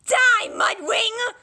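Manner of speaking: slow, drawn-out high war shout; slow screaming battle cry. Acted. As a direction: roar it as you charge. Dragon attacking in battle.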